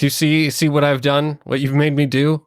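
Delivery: hammed-up anger, pitched high; mock outrage. GM voicing Thea yelling at the idol, mock furious. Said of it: "you're like".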